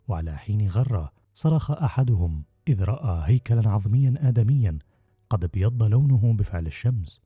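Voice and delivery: ordinary delivery, nothing marked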